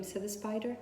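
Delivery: ordinary delivery, nothing marked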